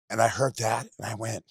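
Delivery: normal talking voice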